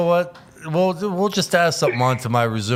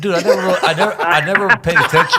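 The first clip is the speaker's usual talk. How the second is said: laughing; 8 semitones higher, 6 dB louder, faster than usual. Real, not acted. Laughing defensively about Dancing.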